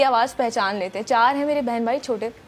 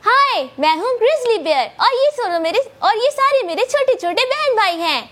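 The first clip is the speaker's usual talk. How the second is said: much higher, louder, squeaky cartoon cub; cute bear cub voice; 12 semitones higher, 5 dB louder than usual. Shown as a demonstration. Voice actor shows the host her cartoon bear cub, cute high squeaky voice. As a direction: chirp it out like a tiny cartoon bear introducing his siblings. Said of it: "اس کی بڑی cute سی voice ہے".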